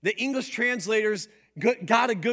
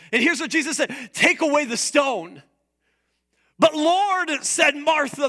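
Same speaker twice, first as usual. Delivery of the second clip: higher, louder, driving sermon delivery; preaching at full force; 7 semitones higher, 6 dB louder than usual. Acted. Preacher retelling Martha at the tomb, at full force. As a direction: preach it out big to the whole room.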